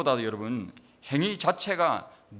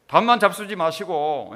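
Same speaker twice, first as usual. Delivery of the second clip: put-on loud, higher-pitched Gyeongsang-accented plea; Gyeongsang dialect; 4 semitones higher, 9 dB louder than usual. Relative pleading with the dead at ancestral rites, loud Gyeongsang dialect. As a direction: imitate a relative praying in Gyeongsang dialect, loudly. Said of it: "경상도 사투리로 그래 하는 거예요".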